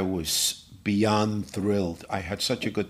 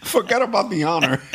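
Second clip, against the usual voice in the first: repeated through laughter, louder and higher; laughing repeat; 9 semitones higher, 5 dB louder than usual. Retold or imitated. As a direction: relish the line while laughing.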